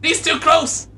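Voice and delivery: high, alarmed cry; acted panic. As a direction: yelp in comic alarm. Panics about a squirrel.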